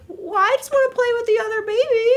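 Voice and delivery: put-on high, small baby lamb voice; high little lamb pleading to play. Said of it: "the little baby is like".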